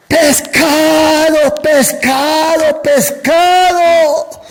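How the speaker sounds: long, high fishmonger's cry; drawn-out, high-pitched hawker's call; street vendor shout. Retold or imitated. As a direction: call out to sell fish across the street.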